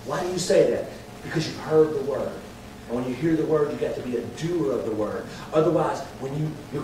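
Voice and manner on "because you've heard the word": usual speaking voice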